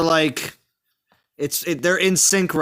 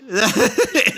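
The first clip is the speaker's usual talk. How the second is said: laughing; 5 semitones higher than usual. Real, not acted. Amused reaction to a teasing remark, laughing. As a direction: burst out laughing, high.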